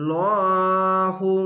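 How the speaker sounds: লহু drawn out very slowly with a full, heavy লাম; heavy lam recitation. Shown as a demonstration. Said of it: "মোটা করে হবে". (মোটা করে টেনে লহু)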